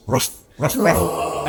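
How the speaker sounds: dog's bark made out of a word. Acted. Talking dog answering its owner's question, barking. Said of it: "He's barking".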